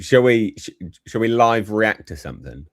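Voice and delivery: ordinary talk, nothing marked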